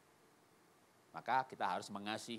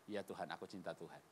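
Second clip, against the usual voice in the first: soft, low reply; humble answer; 7 semitones lower, 9 dB quieter than usual. Acted. Peter's humble answer to Jesus. (jawaban lirih dan rendah hati)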